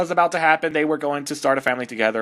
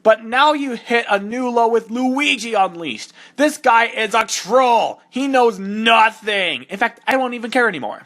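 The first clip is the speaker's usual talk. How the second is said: exasperated rant; 6 semitones higher, 4 dB louder than usual. Real, not acted. Ranting at the fanfic author.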